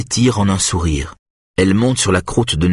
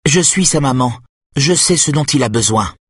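stern and cold; 6 semitones higher than usual. A narrator's reading. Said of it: "Sa voix est sévère et froide".

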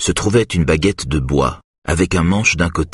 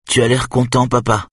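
lightly voiced small boy, higher; a hint of a curious little boy; young boy; 7 semitones higher than usual. A narrator's reading. Little son asking his father, lightly childlike.

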